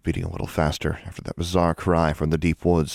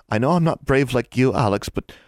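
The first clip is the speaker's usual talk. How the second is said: put-on higher girl, pleading; lightly voiced earnest girl; young girl; 6 semitones higher than usual. A narrator's reading. Anna pleads with Alex, earnest.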